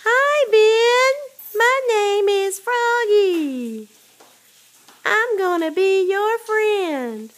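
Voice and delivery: high, loud, slow frog puppet voice; mock frog puppet greeting, high and slow; Froggy voice. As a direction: play the friendly frog talking to the baby. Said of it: "Watch Mommy".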